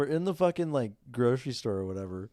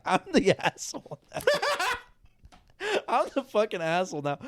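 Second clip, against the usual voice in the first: high, laughing self-blame; helpless laughter breaking into the words; 9 semitones higher than usual. Retold or imitated. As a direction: crack up while confessing. Laughs at his own story.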